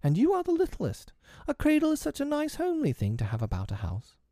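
very high-pitched little mother; high, girlish motherly insistence; coaxing mother. A narrator's reading. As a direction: persuade sweetly in a very high voice. Wendy coaxes Michael about cradle.

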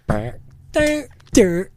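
very high, loud "Brr, dur" nonsense; monkeying at the keyboard; mock silly piano noises. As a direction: imitate clumsy banging on the keys. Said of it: "he starts being like".

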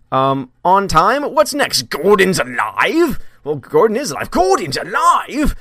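high, slow incredulous question; mock disbelief. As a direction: act stunned he's alive.

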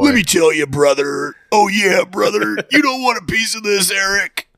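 high, shouting Hulk Hogan-style growl; wrestler promo voice. Retold or imitated. Imitating wrestler promo catchphrases.

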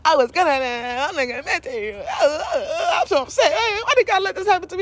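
high, hammed-up wailing brat; mock-cry like a child in a tantrum; exaggerated high-pitched whiny sobbing; inner child tantrum. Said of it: "my inner child having a tantrum".

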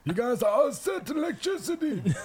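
put-on high, far old electrician voice; grumpy old man. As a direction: play a wheezy old neighbor. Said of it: "Pete, the electrician just came in".

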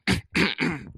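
showy repeated throat clear. Acted. Boyfriend preparing to answer mama, exaggerated throat clearing. Readies his deep voice again.

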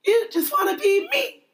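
high-pitched teasing mimic; Aminata. Friend parroting her co-host's line, high mimicked voice. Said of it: "I did that in the Aminata voice".

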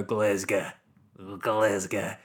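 low, proper-local Glasgow accent; thick Glasgow accent. Shown as a demonstration. Showing how true locals say the city, thick Glasgow accent. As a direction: say it broad Glaswegian, "Glezga", with a short swallowed ending. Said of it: "if you are proper from around the area".

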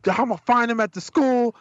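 high-pitched blustering threat; talking trash. Retold or imitated. Dad still vows to track down the kid, blustering trash talk. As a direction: re-enact big talk, voice pushed way up. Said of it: "talking my shit".

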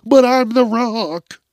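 high, loud, slow indignant plea; pleading celeb. Pretend. The Rock pleading with the cop, high loud and slow. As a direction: protest as the entitled star.

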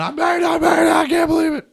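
high, loud, joyful shouting; dad's excited yell. Retold or imitated. Father woken by phone call, shouting with joy. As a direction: burst out yelling in disbelief.

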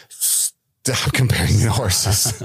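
slow, stern mock reprimand; lightly voiced Galadriel. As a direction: draw it out sternly. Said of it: "I think this is how she said it".

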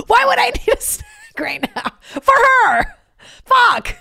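high, loud, half-crying exasperated shout; screaming at the tipsy boyfriend over the stinking dog; exasperated yell. Retold or imitated. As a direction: yell at him in frustrated panic. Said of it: "I was like".

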